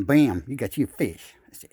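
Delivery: normal talking voice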